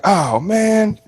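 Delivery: high, impressed gushing; impressed onlookers. Retold or imitated. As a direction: gush like an impressed fan. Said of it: "they'd be like".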